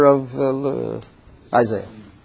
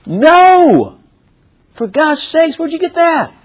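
ordinary delivery

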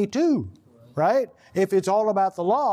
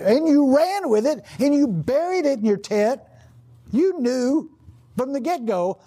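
preaching at full force; 7 semitones higher than usual. Real, not acted. Preaching climax.

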